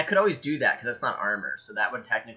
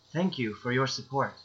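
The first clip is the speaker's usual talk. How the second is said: measured, courteous character voice; polite thanks; 4 dB quieter than usual. Acted. Monk turns to the crowd, politely thanking them. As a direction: address the crowd with mild formality. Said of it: "politely hold my hand up and say".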